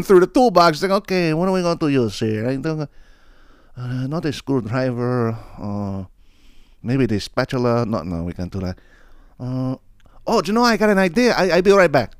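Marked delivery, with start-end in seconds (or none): 1.0-9.7 mock muttering search. Neighbor fumbling through toolbox. 10.3-12.1 sudden idea. Retold or imitated. Neighbor gets sudden idea.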